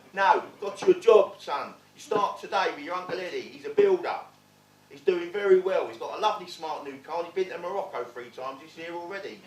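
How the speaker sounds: loud boastful dad voice; a dad selling the job to his son; proud dad. Retold or imitated. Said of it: "dad announces the job".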